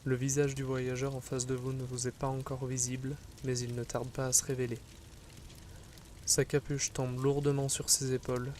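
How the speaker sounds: ordinary delivery, nothing marked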